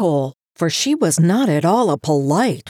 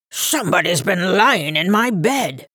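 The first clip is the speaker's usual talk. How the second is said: gruff, growling bear voice at usual pitch; great gruff big bear. A narrator's reading. Big bear finding his bed used, gruff. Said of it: "great gruff voice of the big bear".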